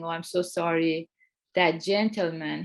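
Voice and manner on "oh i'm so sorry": ordinary delivery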